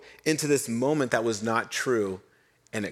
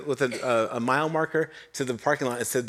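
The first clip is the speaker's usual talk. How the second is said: ordinary talk, nothing marked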